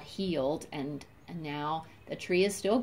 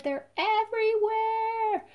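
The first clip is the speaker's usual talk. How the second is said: delighted; 14 semitones higher, 3 dB louder than usual. Real, not acted. Delighted about squirrels.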